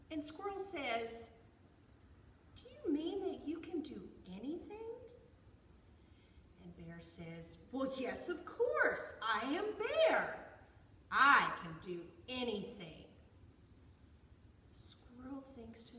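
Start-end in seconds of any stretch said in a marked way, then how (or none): 2.5-4.9 high, louder squirrel voice; questioning squirrel. A narrator's reading. Squirrel questions bear's boast, high-pitched and doubting. 7.7-13.0 loud, emphatic bear declaration; boastful bear. A narrator's reading. Bear answers squirrel's doubt, loud and cocksure.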